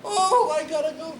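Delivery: sudden high, loud startled cry; panicked exclamation. Retold or imitated. Caretaker caught lingering at breakfast, yelping in sudden panic. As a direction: yelp it like you've just been caught.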